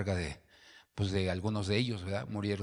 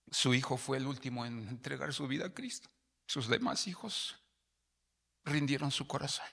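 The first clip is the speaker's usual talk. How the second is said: voice breaking; 4 semitones higher than usual. Real, not acted. Pastor moved telling mother's story.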